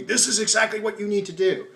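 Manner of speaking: high-pitched, preachy mock command; forceful, didactic lecturing. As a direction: order them about like a pushy preacher. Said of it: "forceful and polemic and didactic".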